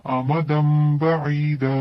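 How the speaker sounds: अमदम बईदा very slowly, m joining into b; slow recitation. Shown as a demonstration. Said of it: "अमदन नहीं पढ़ेंगे". (अमदम बईदा बहुत धीरे)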